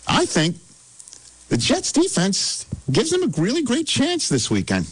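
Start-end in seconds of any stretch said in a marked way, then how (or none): 1.5-4.8 high-pitched hopeful prediction; raised, playful hot-take voice; higher register. Pretend. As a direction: send the voice up for a bold claim. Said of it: "Pretty high".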